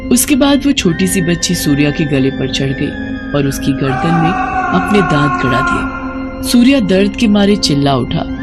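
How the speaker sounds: ordinary delivery, nothing marked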